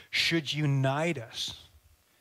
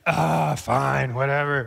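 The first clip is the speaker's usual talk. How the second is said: loud, exasperated grumble; grumbling; 2 semitones lower, 6 dB louder, slower than usual. Acted. Reluctant pack carrier obeying a soldier, grumbling loudly. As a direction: give in with a big put-upon groan. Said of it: "grumbling the whole way".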